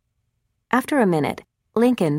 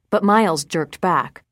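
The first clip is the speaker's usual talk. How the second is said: plain reading voice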